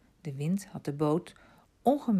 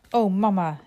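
put-on a short, louder child's exclamation; called out; 2 semitones higher, 6 dB louder than usual. A narrator's reading. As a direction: call out to mama, moderately. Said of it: "riep Arthur".

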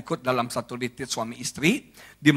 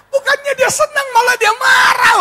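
comic outraged shout; 10 dB louder than usual. Acted. Wife got angry instead.

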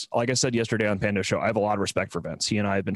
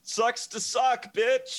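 mocking taunt; 14 semitones higher, much slower than usual. Mocking taunt at quoted player.

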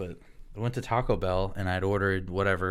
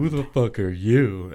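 slow, louder mock challenge; threatened boyfriend; 2 semitones higher, 7 dB louder, slower than usual. Boyfriend confronting a stranger among the girls, slow loud mock challenge. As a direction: draw it out like a jealous tough guy. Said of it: "They're threatened".

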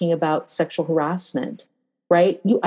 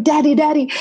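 higher, alarmed call to dad; 10 semitones higher, 4 dB louder than usual. Retold or imitated. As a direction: replay the jolt of fear as she ran to him. Ran to her hurt dad.

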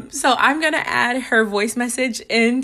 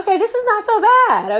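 pitched way up, forced calm; high-pitched; 10 semitones higher than usual. Retold or imitated. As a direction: squeak out a brave face. Calm self-talk in water.

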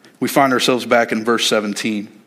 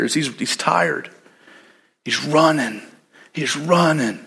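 ordinary talk, nothing marked

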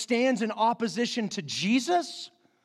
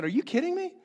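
smiling disbelief; 9 semitones higher, faster than usual. Retold or imitated. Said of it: "I just smiled and I said".

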